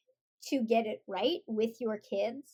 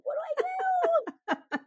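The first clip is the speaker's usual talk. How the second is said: high, drawn-out comic wail; slow, squealed fake panic; mock helplessness; slower than usual. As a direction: wail it in pretend despair, near laughter. Retells comic helplessness to son.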